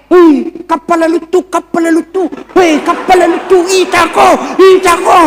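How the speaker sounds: put-on high excited child calling out, shouting by the last words. Village boy calls to his friends about the plane, excited shouting. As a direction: point and call at the plane overhead.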